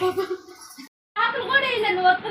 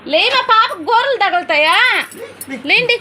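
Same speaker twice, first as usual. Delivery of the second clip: loud; 6 dB louder than usual. Real, not acted. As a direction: call it out so the little one hears. Talking loudly to child.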